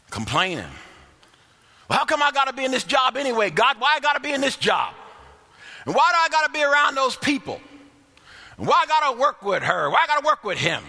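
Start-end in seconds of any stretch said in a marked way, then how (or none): 1.9-10.8 hammed-up griping to God; mock grumbling. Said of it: "They started grumbling".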